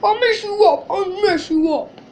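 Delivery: loud, high whooping jeer; mocking imitation. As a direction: hoot it over and over, hammed-up. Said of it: "somebody's laughing".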